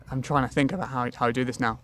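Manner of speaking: ordinary talk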